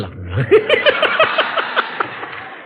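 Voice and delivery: preacher cracking up at his own story; high laugh. Real, not acted. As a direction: burst out laughing.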